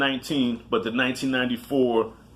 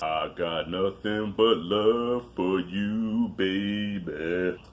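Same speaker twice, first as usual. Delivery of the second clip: movie quote voice; 4 semitones lower, 3 dB quieter, much slower than usual. Retold or imitated. Quoting movie line in character.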